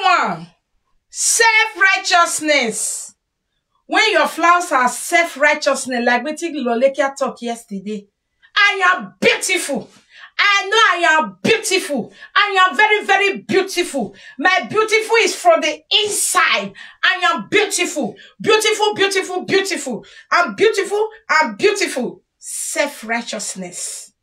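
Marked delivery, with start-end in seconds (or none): 1.1-3.1 slow announcement. Shown as a demonstration. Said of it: "announces the next flaw". 8.6-22.2 higher, preening self-praise; sing-song mock brag, full of herself; mock vain boasting. Mocking a vain self-righteous person.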